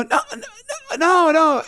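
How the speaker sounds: very high, wailing mock protest; squeaky imitation of "no, no"; panicked polite protest. Couple protesting, retold.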